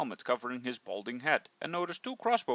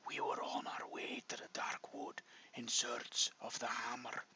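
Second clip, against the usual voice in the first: dying whisper, much softer; low whisper; 2 semitones lower, 13 dB quieter than usual. Acted. Dying dwarf pleading with the hero, whispered. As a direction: breathe the words out, barely voiced. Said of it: "in a low whisper".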